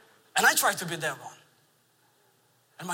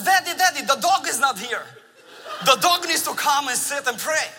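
put-on higher, louder little girl calling Daddy urgently; mock toddler interrupting; 6 semitones higher, 5 dB louder than usual. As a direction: play the two-year-old breaking into prayer. Daughter interrupts prayer about dog.

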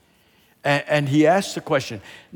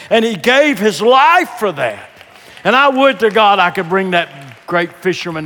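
loud, raised preacher's voice; full-force preaching; 8 semitones higher, 10 dB louder than usual. Real, not acted. Preacher insisting why Peter died, full force. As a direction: drive the argument home loudly.

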